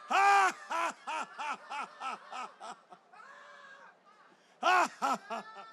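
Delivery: loud bursts of ha ha fading down; hearty ha ha, each burst fading; forced laughter. Shown as a demonstration. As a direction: lead a laughter yoga round. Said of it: "Keep laughing".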